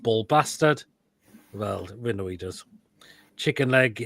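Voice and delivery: usual speaking voice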